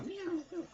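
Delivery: very high, soft imitated meow; cat meowing. Preacher imitating a cat, high mewing. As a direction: mew like a cat in trouble. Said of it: "o un gato".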